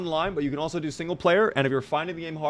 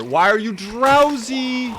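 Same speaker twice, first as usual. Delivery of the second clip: exasperated; 10 semitones higher, 7 dB louder, slower than usual. Real, not acted. Scolding his weapon.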